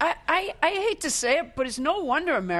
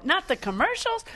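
lightly imitated disbelief, pitched up; higher, put-on surprise; mock incredulous question; 9 semitones higher than usual. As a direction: ask it as an astonished acquaintance would.

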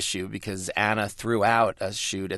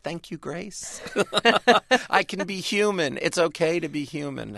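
high, tiny, grateful little voice; 8 semitones higher than usual. Retold or imitated. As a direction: squeak it out like a tiny grateful fan. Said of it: "you hear that tiny little voice".

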